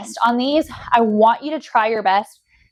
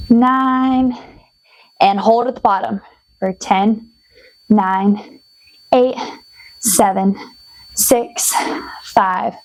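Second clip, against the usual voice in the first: heavy breathing; much slower than usual. Real, not acted. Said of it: "so excuse my breathing hard".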